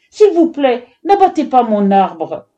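loud, desperate begging; tearful plea. A narrator's reading. Girl pleading with the woodcutter, tearful. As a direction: start high and beg him, falling off. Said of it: "s'écria-t-elle, les larmes aux yeux".

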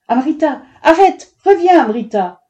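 high, loud, alarmed call; crying out. A narrator's reading. Mother calling her running daughter back, shouting. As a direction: call the child back urgently. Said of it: "les cris de sa mère".

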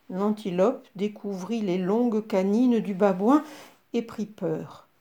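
usual speaking voice

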